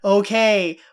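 mock agitation swelling to a loud finish; increasingly agitated. Podcaster acts out the girl pushed to leave, increasingly agitated. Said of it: "getting more agitated".

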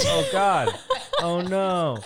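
high breathy gasp of shock; oh God, high and loud. Retold or imitated. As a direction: gasp it out, horrified. Shocked at finding her naked.

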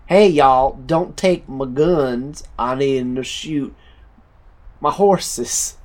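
mock American accent. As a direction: call out like a caricature gun-loving American. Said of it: "say something an American would say".

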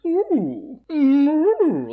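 high, loud drawn-out "ooh"; imitated accent noises. Shown as a demonstration. Co-host guessing how the accent sounded, mock hooting. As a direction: imitate a put-on voice in wordless hoots. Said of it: "Did it sound like".

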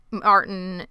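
usual speaking voice

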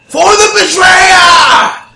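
roaring war cry, drawn out; battle shout. Acted. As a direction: bellow it like a charging monster.

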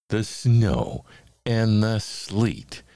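ordinary delivery, nothing marked

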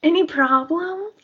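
very high, mock-innocent question; pry sweetly, as a joke; hammed-up singsong fishing for trouble; playfully nosy. Continues pretend nosy questioning.

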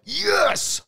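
high, whooping yes; excited cheer. Retold or imitated. Speaker retelling the room's cheer, high and excited. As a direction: cheer like you scored. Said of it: "the excitement in the room was like".

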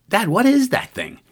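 high-pitched imitation of an astonished daughter; amazed daughter. Daughter asks dad about the object, amazed. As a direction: voice a child's baffled question, pitched well up.

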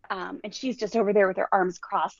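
ordinary delivery

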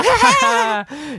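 very high, loud "ha", then falling away; hammed-up whooping movie laugh; imitated triumphant laugh.

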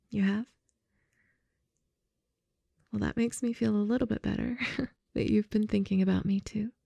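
gently admitting you missed them; making up. Acted.

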